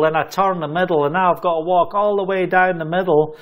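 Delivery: ordinary delivery, nothing marked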